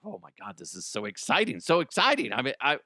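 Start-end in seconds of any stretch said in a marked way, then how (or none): none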